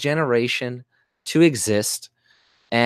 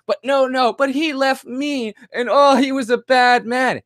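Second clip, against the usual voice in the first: ape her protest, pitched way up; mocking woman; 7 semitones higher than usual. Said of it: "you're just like a woman who can't fucking take it".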